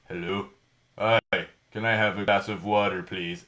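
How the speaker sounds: put-on low voice, a little guy with a man's voice; mock your younger self, comically deep and polite; deep-voiced ten-year-old. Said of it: "I don't have the deepest voice around".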